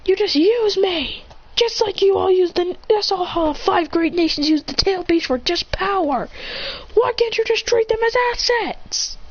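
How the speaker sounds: put-on boy running away, crying out that he's used, high and hurt; hurt protest. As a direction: plead against being used. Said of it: "Chris would run away saying".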